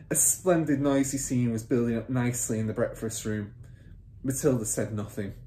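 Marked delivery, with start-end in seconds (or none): none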